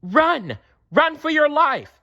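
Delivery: shrill it out like a panicked little bird; high frantic warning to the fox; shrill cry. A narrator's reading. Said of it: "shrilled Thrush".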